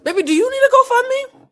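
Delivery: high, loud mock-sympathetic coo; mock pity. Real, not acted. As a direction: coo it like offering charity, dripping sarcasm. Mocking the creator about money.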